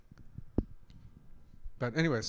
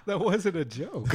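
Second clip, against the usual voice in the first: high, loud, slow laughing protest; cracking up while objecting; 8 semitones higher, 11 dB louder, slower than usual. Real, not acted. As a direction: say it through big laughter. Laughing at own drink remark.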